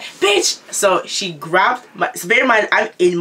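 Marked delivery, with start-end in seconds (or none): 0.0-0.6 high-pitched outraged insult, imitated; acted angry retort. Fuming girl answering teller at the door, high mock outrage. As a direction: fire it out high, mimicking outrage.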